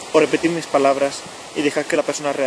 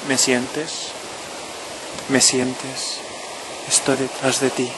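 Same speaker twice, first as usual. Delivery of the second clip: right in your ear; 5 dB quieter than usual. Acted. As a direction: murmur it close and calm, like a guide. Guided intimate voice before instructions.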